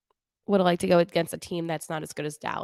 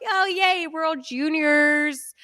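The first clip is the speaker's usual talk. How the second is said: high, bouncy mock-excited yay, slowing on World Juniors; holly jolly cheer; 6 semitones higher, much slower than usual. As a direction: play up the festive excitement. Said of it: "I'm, like, in the holly jolly mood".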